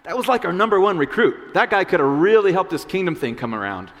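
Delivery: high, loud disbelief; baffled disciples. Acted. Disciples baffled the rich ruler walked away, loud disbelief. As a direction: gape at losing the star recruit. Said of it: "the disciples were like".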